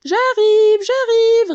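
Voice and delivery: calling back. A narrator's reading. Queen answering a call from afar.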